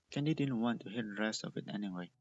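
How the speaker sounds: ordinary delivery, nothing marked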